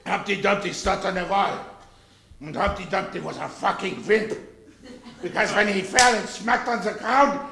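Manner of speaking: mock German nursery-rhyme retelling; put-on German-accented comic storyteller. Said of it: "Your version of Humpty Dumpty".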